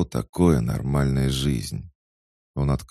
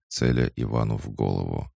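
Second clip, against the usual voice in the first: ordinary delivery, nothing marked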